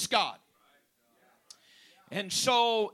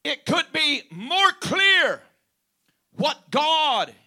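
preaching emphasis; 4 semitones higher, 6 dB louder, slower than usual. Real, not acted. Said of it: "preacher rephrasing loudly".

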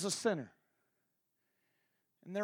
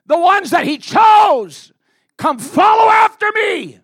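full-force preaching; 21 dB louder than usual. Acted. Preacher rebuking the congregation for judging, full-force preaching. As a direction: thunder it out to the congregation.

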